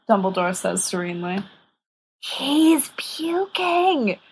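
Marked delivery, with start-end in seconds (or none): 2.2-4.2 voiceless whisper, very slow; mouthed whisper. Acted. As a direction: mouth it silently behind his back. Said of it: "Peter mouths".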